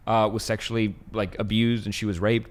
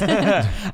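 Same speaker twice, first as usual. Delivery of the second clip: laugh; 7 semitones higher than usual. Real, not acted. Laugh after the joke.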